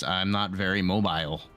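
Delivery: usual speaking voice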